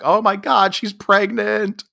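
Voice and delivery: high, stretched mock wail; mock outburst. Mother reacting as daughter storms out, mock-dramatic outburst. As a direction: exclaim it high, dragging out the end.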